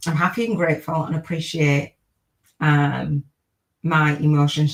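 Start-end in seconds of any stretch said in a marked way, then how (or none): none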